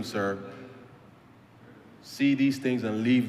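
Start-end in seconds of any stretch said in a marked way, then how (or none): none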